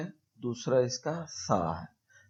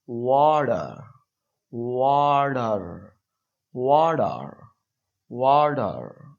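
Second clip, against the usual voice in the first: American accent; 7 dB louder, much slower than usual. Shown as a demonstration. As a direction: stretch out वाटर the American way, T as D, R sounded. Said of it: "वो कहेंगे ... डी की तरह पढ़ेंगे".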